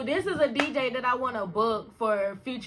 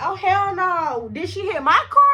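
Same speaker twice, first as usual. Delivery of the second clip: alarmed outburst; 7 semitones higher, 5 dB louder than usual. Retold or imitated. Fearing friend hit her car.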